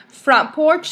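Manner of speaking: ordinary delivery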